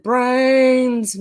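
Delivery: loud, high, drawn-out zombie wail; mock zombie call. Streamer reading a zombie joke from chat, mock wail. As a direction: stretch it like a hungry zombie.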